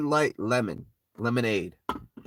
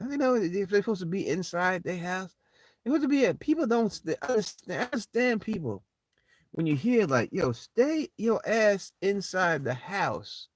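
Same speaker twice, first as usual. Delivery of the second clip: higher, complaining at the neighbors outside; exasperated rant; 11 semitones higher than usual. Real, not acted. Venting at neighbors hanging outside, higher exasperated rant. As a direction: scold them from the window, fed up.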